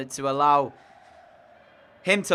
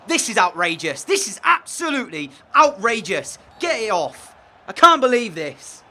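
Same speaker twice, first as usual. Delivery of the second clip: rising, louder outrage; outraged; 5 semitones higher, 7 dB louder than usual. Real, not acted. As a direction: lose your head at the screen. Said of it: "I'm losing my head".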